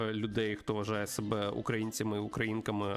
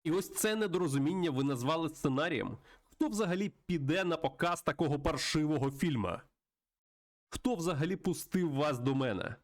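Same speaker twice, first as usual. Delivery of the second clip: higher, indignant scolding; exasperated, contemptuous rant in a raised pitch; irate studio boss; 5 semitones higher than usual. Acted. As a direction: tear into a hopeless scriptwriter with scorn. Plays angry film boss.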